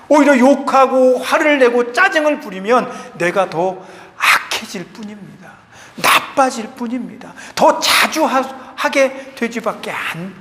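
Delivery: ordinary talk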